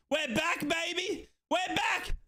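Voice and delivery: high, shouted gloating chant; triumphant cheer. Real, not acted. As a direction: crow it like your team is back on top. Celebrating the goal.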